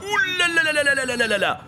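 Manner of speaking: high, fast, loud panic cry; panicked yelp. Acted. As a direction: yelp in alarm. Captain panics at the threat.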